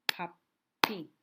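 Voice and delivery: Puppy split as PUP-PY, loud and separated; clapped syllables. Shown as a demonstration. Teacher to first graders, clapping Puppy's syllables. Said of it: "Let's clap to".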